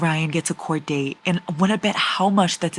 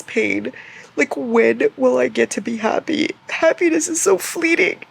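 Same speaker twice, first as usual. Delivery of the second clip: whiny lament; 7 semitones higher than usual. Real, not acted. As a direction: wail it high and sorry.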